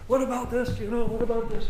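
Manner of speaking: high, loud, insistent questioning; pestering wife. Retold or imitated. Wife follows her husband around, pressing him loudly. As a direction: act out the wife chasing after him.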